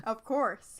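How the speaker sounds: normal talking voice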